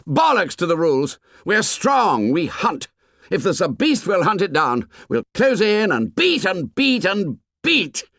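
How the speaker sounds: shouting. Acted. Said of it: "Jack was shouting against him".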